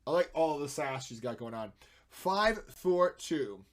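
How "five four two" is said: drawn out. Pretend. Drawn out number.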